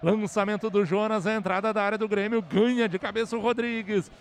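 fast, building radio call; play-by-play. Real, not acted. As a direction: speed up as the attack builds. Last-minute attack call.

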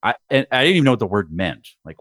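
usual speaking voice

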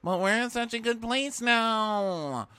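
very high, slow, sing-song voice; sugary Amanda. Retold or imitated. Amanda reassuring the group, high sweet coo. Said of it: "Amanda's like".

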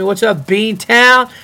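loud, drawn-out call to the city; excited shout-out. Real, not acted. Podcast host calling out to Boston listeners, loud and excited. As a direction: hype up the Boston crowd.

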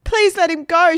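high, loud desperate plea; pleading shout. A narrator's reading. Margaret to waitresses holding Egbert, pleading shout. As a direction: cry out to save him. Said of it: "shouted Margaret".

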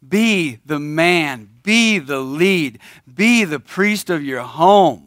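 each "be" hit hard and slow; slow exhortation. Real, not acted. Preacher to the men in church, slow and punchy on "be". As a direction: hammer every "be" like a command.

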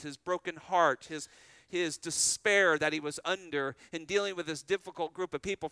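ordinary delivery

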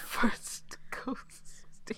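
laughing. Real, not acted. Laughing retelling of the ghosting joke.

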